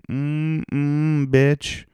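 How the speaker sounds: high, loud sassy "mm-mm, bitch"; high and loud, a hammed-up foodie; sassy food moan. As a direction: savour it with diva sass. Savouring the food.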